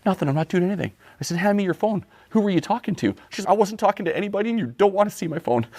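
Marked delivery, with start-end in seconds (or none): 0.1-0.9 higher, lightly voiced defensive wife; wife's voice. Wife's voice in story. 1.4-3.1 high, hammed-up jealous husband; mock demand. Demanding phone in story. 3.5-5.7 higher and quick, lightly voiced wife; wife's voice. Wife's voice in story.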